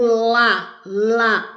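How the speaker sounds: "lá" punched out on its own; stressed syllable LÁ. Shown as a demonstration. Teacher isolating the strong syllable, a firm stressed "lá". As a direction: hit each "lá" firmly as a separate syllable. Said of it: "O lá é a sílaba mais forte".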